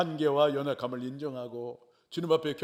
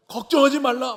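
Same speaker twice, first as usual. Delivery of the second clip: booming promise; 13 semitones higher, 14 dB louder than usual. Retold or imitated. God answering the praying believer, booming and commanding. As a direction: thunder out God's assurance. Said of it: "말씀해 주시는 거예요".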